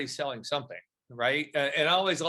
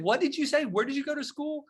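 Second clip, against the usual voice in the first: much higher, incredulous retold question; astonished re-asking; 12 semitones higher than usual. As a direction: ask as if you can't have heard right.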